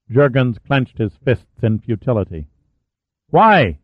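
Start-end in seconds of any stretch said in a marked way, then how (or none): 3.3-3.8 a higher, louder demand; sharp demanding question, pushing for an answer. A narrator's reading. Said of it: "he demanded".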